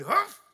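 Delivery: loud high mock bark; dog bark. Big dog barking at the pastor, loud bark.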